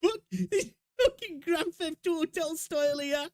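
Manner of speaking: cracking up in disbelief; laughing through a real what-the. Real, not acted. Reacting to meme stages.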